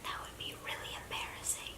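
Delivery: hushed whisper so nobody hears. Real, not acted. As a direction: keep it to a whisper. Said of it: "this house is full of people".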